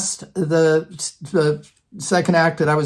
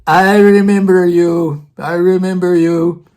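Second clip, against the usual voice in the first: put-on slow, drawn-out Russian-accented professor; 5 dB louder, slower than usual. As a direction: imitate the old professor slowly recognising someone, Russian accent. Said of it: "in his Russian accent".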